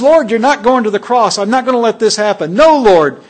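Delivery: loud, high protest; Peter's loud protest; emphatic refusal. Acted. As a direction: push back hard, refusing it. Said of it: "Peter says".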